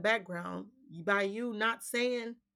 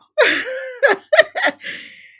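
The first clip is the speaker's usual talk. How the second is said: high burst of laughter; 12 dB louder than usual. Real, not acted. Laughs at her own impression.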